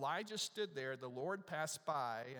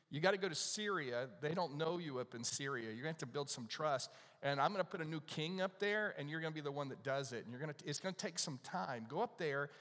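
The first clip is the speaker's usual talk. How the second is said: urgent preaching, voicing God sending a prophet out; building commission; 3 semitones higher, 5 dB louder than usual. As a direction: rattle off orders with mounting force. Voicing God's urgent commission to Elijah.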